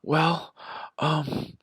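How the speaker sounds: high, loud whiny imitation of the sister; hammed-up teary sister voice; mock sister crying. Imitating his tearful sister when he confronts her, high and whiny. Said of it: "she starts crying and she's just like".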